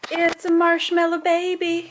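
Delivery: loud, slow delighted coo; excited cooing. Real, not acted. Cooing at bundled baby.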